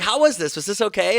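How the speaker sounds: high, eager asking voice; eager parent. Retold or imitated. Uncle checking kids enjoyed the lights, high and eager. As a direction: ask sweetly, wanting approval. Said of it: "I'm like".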